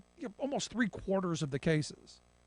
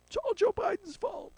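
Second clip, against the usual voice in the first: much higher, whiny mock voice; mocking squeal; 14 semitones higher than usual. Host mocking Republicans in a high whiny voice. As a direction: squeak it like a whining critic. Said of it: "Republicans are saying".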